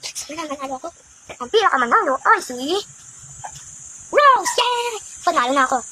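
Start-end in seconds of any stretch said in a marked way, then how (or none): 4.1-4.9 very high, loud winner call; excited cheer. Acted. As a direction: burst out with joy at winning. Winner announced, joy. (masayang sigaw ng panalo)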